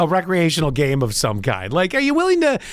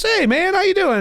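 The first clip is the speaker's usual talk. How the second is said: high cheery customer; 13 semitones higher than usual. Acted. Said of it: "he goes".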